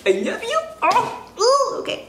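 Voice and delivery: high sing-song cooing at the dog; high pet cooing. Real, not acted. Cooing at her dog while opening gifts, high pet voice. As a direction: coo up high like talking to a puppy.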